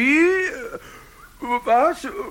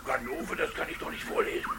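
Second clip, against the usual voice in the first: whispering; 11 dB quieter, much faster than usual. Acted. Caliph aside about insulting speech.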